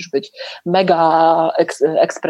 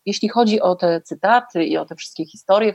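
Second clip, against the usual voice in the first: ordinary delivery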